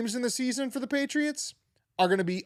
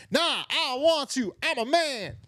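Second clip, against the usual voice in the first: a hint of a tough Boston guy brushing it off; thick Boston accent; 10 semitones higher than usual. Boston man refusing to talk to reporter, mock Boston accent. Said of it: "some guy with a thick Boston accent being like".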